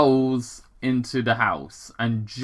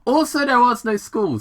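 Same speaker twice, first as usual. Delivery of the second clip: put-on high, louder cheeky kid voice; young Sonic; 11 semitones higher, 4 dB louder than usual. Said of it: "Sonic continued".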